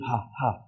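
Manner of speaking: HA, HA as soft puffs of breath; aspiration. Shown as a demonstration. Teacher demonstrates the aspiration to learners, breathy soft puffs. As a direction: breathe out HA, HA softly. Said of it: "the aspiration".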